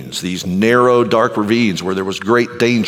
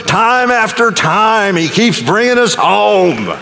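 raised, high-pitched sermon building to a climax; rousing preaching; 10 semitones higher than usual. Real, not acted. Preacher tells congregation the Good Shepherd carries them home, rousing preaching. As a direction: drive up to a high, ringing appeal, stretching the last phrases.

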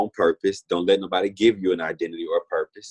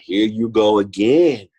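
louder, slow, higher mock exasperation; exasperated; 5 semitones higher, 7 dB louder, slower than usual. Telling her friend how tired she was of the prophecies, exasperated. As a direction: roll your eyes at yet another prophecy.

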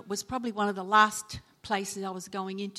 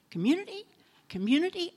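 rising, puzzled repeat; a pastor's ears pricking up; surprised; 7 semitones higher, faster than usual. Retold or imitated. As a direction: perk up at the word, questioning. His ears perk up at the word.

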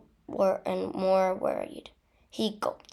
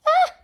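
short loud yelp; 10 dB louder than usual. A narrator's reading. Hermione dodging a curse, yelping. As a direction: yelp as if nearly hit. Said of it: "yelped Hermione".

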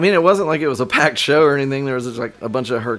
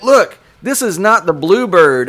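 stern dad; 5 semitones higher, 5 dB louder than usual. Retold or imitated. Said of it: "he was like".

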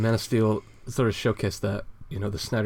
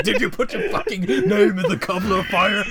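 much higher, louder, laughing mock yell; furious mock Dumbledore; 10 semitones higher, 5 dB louder than usual. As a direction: shove him against the wall and demand an answer. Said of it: "running up to him and, like, shoving him".

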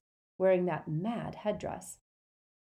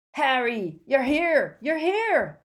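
much higher and louder, overjoyed; delighted Hagrid; 8 semitones higher, 9 dB louder, slower than usual. A narrator's reading. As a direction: greet him with booming joy. Hagrid overjoyed at finding Harry.

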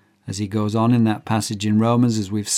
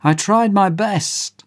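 pleading; 9 semitones higher than usual. Acted. Condemned man pleading.

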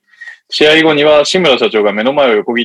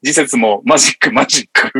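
dramatic anime next-episode narration; Eva preview narrator; 4 semitones higher than usual. Acted. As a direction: read it like an Evangelion teaser. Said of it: "エヴァ次回予告風投稿を作成しました".

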